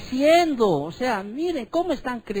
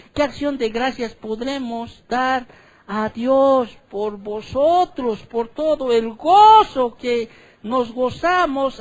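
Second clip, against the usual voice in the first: raised, slow, emphatic Bible reading; higher, louder solemn scripture read; preaching scripture reading; 4 semitones higher, 6 dB louder, slower than usual. Acted. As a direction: proclaim the verse to the congregation, drawing it out. Reads scripture aloud in preaching style.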